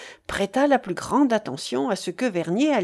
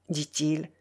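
plain reading voice

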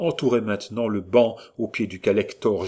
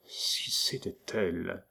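much softer and slow, half to himself; a slow, hushed private thought; thought aloud; 2 semitones lower, 8 dB quieter, slower than usual. A narrator's reading. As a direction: let the thought slip out under the breath. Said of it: "ces mots vinrent jusqu'à ses lèvres".

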